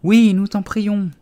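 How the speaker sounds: slow, high, loud plea; imploring group cry; exclaiming in chorus. A narrator's reading. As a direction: call out drawn-out as a whole crowd. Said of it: "s'exclamèrent en chœur".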